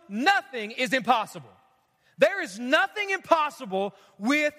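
impassioned sermon delivery; preaching. Acted. Pastor urging the church to pray, preaching. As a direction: drive it home to the congregation.

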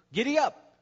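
high, loud horse command; shouted command. Acted. Man urging a stubborn horse, shouted command. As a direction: shout to get the horse moving.

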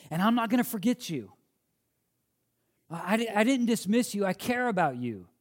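high, loud, pleading voice of Paul; impassioned preaching. Acted. Preacher voicing Paul's care for the church, impassioned. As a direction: preach it like Paul begging his flock.

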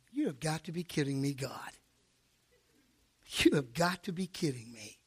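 quiet, low-pitched exasperation to himself; under-the-breath incredulous grumble; low disbelieving mutter. Retold or imitated. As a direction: mutter it privately to God in disbelief. Muttered thought to God.

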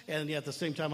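ordinary talk

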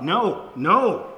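loud, high mock toddler "no"; imitated toddler yell. Preacher shows children how a toddler yells out, loud mock protest. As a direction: yell it like a balking two-year-old. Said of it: "Sometimes they yell out".

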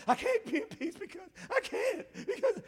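high, flustered mock whine; mock whiny panic. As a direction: whine it as if falling apart. Mimics the wrong, panicking spirit.

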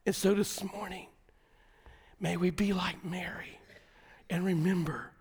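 soft, whispered reflection; hushed prayer. Acted. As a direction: bring the church down to a hush. Closing prayerful hush.

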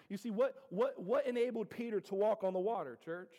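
ordinary talk

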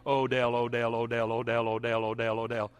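low monotone drone of one name; mock repetitive prayer. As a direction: chant it mindlessly without a break. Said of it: "if I did that for twenty minutes".

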